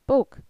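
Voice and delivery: "bok" high and short, with a tighter vowel; learner's attempt. Speaker echoing host brother, learner's short "bok". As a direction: repeat "bok" briskly, mouth not opened inside. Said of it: "I thought I was repeating him exactly".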